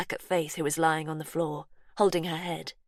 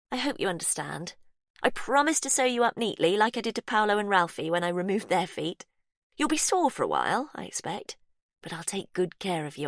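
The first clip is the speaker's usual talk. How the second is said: Faith's voice. Acted. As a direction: coo to the victim, falsely kind.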